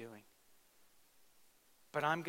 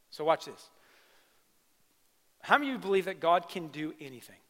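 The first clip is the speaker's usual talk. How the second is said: ordinary delivery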